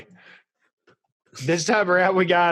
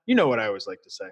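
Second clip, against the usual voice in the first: low mock-serious voice; 8 semitones lower, 3 dB quieter, much faster than usual. Repeats the catchphrase lower.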